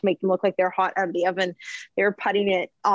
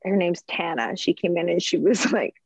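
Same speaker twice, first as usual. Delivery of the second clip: usual speaking voice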